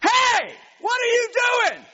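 high, loud confrontational shout; full-voiced indignant yell; angry yell. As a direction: holler across the water at someone in the wrong. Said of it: "I yelled at the guy".

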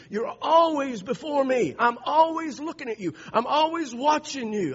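loud, high, building sermon climax; full-force preaching. Acted. Preacher voicing Jesus and the Lord, booming and rising. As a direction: preach it to the back row.

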